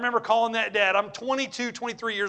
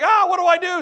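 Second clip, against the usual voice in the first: mock panic; 9 semitones higher, 8 dB louder than usual. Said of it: "I'm like".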